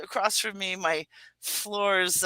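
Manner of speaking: ordinary delivery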